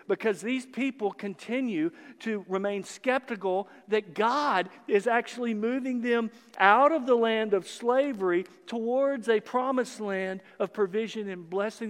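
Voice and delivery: ordinary delivery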